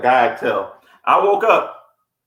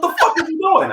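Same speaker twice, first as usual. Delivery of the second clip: snapped out. Retold or imitated. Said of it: "snapped out".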